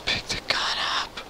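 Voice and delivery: urgent whisper. Real, not acted. Quiet urging while hiding.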